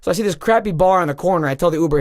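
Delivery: ordinary talk, nothing marked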